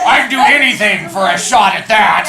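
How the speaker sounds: loud, high mock man voice while laughing; hammed-up man voice through laughter. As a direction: bark it out like a guy. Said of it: "Just say it in a man voice".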